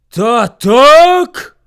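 very loud, high exclamation; bear's outraged cry. A narrator's reading. Bear answers the echo, outraged shout. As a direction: cry out in indignation. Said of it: "скрикнув ведмідь".

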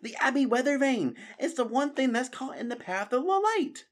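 put-on a young mouse catching on; Matthias agreeing. Matthias agreeing with Constance about the vane, excited high voice.

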